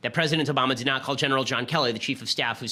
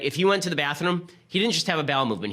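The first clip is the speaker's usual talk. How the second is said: ordinary talk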